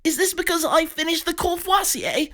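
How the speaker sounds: high frantic question; panicked Pig. Acted. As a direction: blurt it high in fear.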